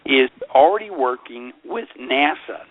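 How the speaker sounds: usual speaking voice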